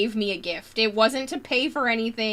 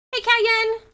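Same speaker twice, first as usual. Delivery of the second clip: excited greeting; 13 semitones higher, 9 dB louder than usual. Real, not acted.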